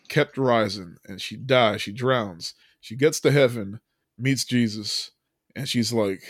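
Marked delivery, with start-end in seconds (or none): none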